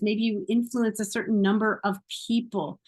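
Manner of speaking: ordinary talk, nothing marked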